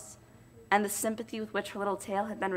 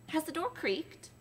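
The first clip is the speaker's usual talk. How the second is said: nervous hush; 8 semitones higher, 6 dB quieter than usual. Acted. Wife fearing eavesdropping husband.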